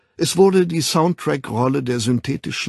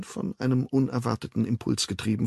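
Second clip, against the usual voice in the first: usual speaking voice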